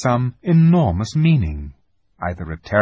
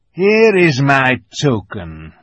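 lisping buck-toothed beaver; 4 semitones higher, 6 dB louder, slower than usual. Acted. Beaver voiced by narrator.

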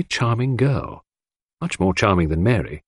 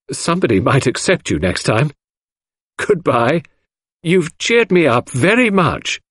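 higher, slower, lightly voiced teasing girl; merry Cynthia; 6 semitones higher than usual. A narrator's reading. Cynthia cheerfully saying goodbye to Hastings, higher and teasing. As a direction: tease him gently on the way out.

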